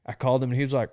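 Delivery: usual speaking voice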